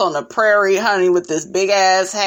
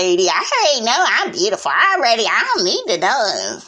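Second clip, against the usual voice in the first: mock diva; faster than usual. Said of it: "confessional being shady".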